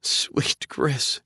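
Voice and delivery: put-on sobbing young woman, whispered. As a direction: choke the name out through tears. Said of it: "she sobbed".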